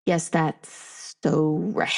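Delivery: breathy, low, very slow agreement; a touch of low, slow hushed awe; awed agreement. Acted. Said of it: "And now me is like".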